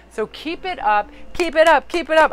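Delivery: building cheer. Real, not acted.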